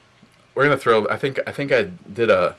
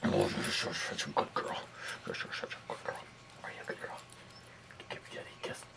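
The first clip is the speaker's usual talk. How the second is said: whispering to a dog; 12 semitones lower, 15 dB quieter than usual. Real, not acted. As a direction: whisper sweet praise to the pet.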